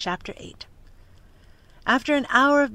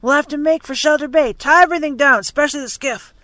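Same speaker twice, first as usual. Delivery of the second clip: loud, high urgent commands; shouted orders; 5 semitones higher, 9 dB louder than usual. A narrator's reading. Captain ordering the crew to secure everything, loud shout. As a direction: bark orders over the gale.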